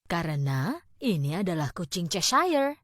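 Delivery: duchess. A narrator's reading. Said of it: "kata wanita bangsawan itu".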